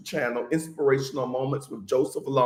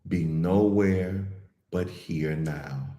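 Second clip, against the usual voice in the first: guided meditation; 6 semitones lower than usual. Real, not acted. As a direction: ease listeners gently into calm, unhurried and even.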